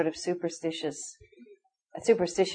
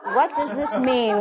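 laughing mock puzzlement; 6 semitones higher, 3 dB louder, slower than usual.

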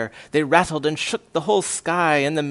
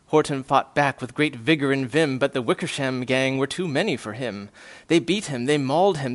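ordinary delivery, nothing marked

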